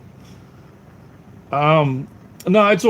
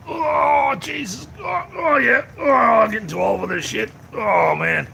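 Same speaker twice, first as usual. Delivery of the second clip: high, strained groans of exertion; groaning with effort; 11 semitones higher, slower than usual. Real, not acted. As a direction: heave yourself up, groaning through the words. Groaning as he gets up to walk.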